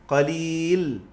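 ordinary talk, nothing marked

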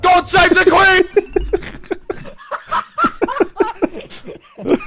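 patriotic shout. Acted. Player ending the British bit, hammed-up patriotic shout. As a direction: belt it like a mock patriot.

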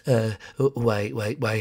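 creepy neighbor. Acted. Creepy neighbor introduces himself.

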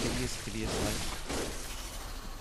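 creepy whisper. Acted. Gamer muttering about grim world.

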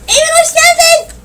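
high, slow, sing-song mocking welcome; mock greeting. Mocking the cousin who barged in, high sing-song welcome. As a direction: parody a silly kid's greeting.

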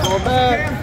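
loud high call to fall back; shouting orders over battle. Real, not acted. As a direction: bark it across the court. Parent shouting at basketball game.

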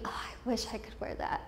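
hushed, almost whispered longing; wistful private thought. Retold or imitated. Girl watching other girls' clothes at assembly, hushed and wistful. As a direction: breathe it out very softly, as if to yourself. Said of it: "watching certain girls and thinking".

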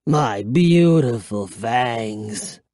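slow, low mournful moan; drawn-out undead lament; moaning vampire. A narrator's reading. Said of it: "I heard him moan".

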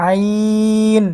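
আইন from a pressed throat, long held ee; three-alif stretch. Shown as a demonstration. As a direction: squeeze the throat, then stretch the ee of আইন evenly. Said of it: "গলাটা একটু চাপ দিয়ে". (গলায় চাপ দিয়ে আইন টেনে)

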